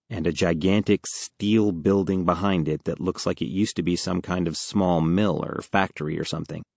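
usual speaking voice

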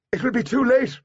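high, strained calling voice; urgent warning. A narrator's reading. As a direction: call out that hope is gone. Artemis rejects rescue from inside dome.

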